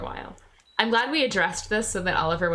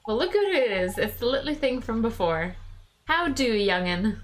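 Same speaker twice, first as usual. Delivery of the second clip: lightly voiced regional Drippy accent; chummy sidekick in his non-standard English; a hint of a folksy accent; Drippy's accent; 4 semitones higher than usual. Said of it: "writing in that accent".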